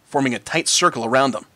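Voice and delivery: ordinary delivery, nothing marked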